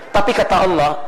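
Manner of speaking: normal talking voice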